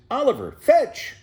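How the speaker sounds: high, loud call to a pet; voiced owner calling his tortoise. A narrator's reading. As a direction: call out like tossing a stick. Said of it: "Ike throws a stick and says".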